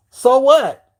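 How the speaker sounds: loud defiant so what. Real, not acted. Telling off the chat, loud and defiant.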